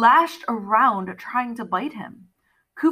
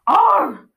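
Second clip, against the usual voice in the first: loud, high baboon screech; high, forceful ape call; insistent baboon cry; 13 semitones higher, 8 dB louder than usual. A narrator's reading. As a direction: insist with one sharp animal bark. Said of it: "Khufu insisted".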